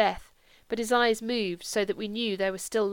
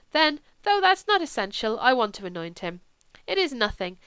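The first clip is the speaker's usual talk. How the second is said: earnest priest, higher at the start of phrases; persuasive priest; 3 semitones higher than usual. Acted. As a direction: plead your case, reassuring and emphatic. Priest pleads his case.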